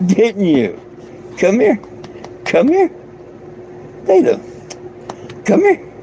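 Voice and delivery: very high sing-song calling the dog; high dog talk. Real, not acted. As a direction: coax the pup over in a squeaky high voice. Talking to her dog.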